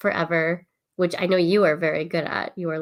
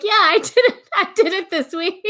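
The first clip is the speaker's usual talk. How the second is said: fake cheerful; 11 semitones higher, 6 dB louder than usual. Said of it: "I've been like".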